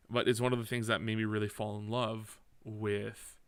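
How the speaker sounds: normal talking voice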